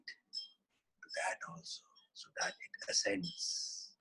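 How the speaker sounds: slow, hushed whisper; whispered blessing. Acted. Teacher whispering a blessing to the class, hushed. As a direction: breathe the blessing softly.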